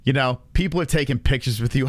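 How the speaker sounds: normal talking voice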